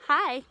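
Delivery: Hi said loud and clear with long I; modelled word. Shown as a demonstration. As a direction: stretch the long I in Hi. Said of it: "H I. Long I sound".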